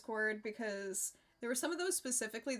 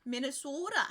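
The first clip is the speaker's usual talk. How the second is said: put-on Minnesota accent; 3 semitones higher than usual. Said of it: "Minnesota stereo-, stereotype accent, though".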